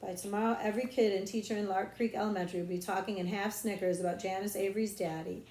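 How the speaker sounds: ordinary delivery, nothing marked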